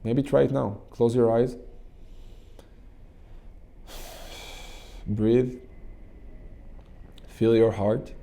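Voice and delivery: slow, calm guiding voice; guided meditation. Acted. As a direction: lead them gently into the exercise.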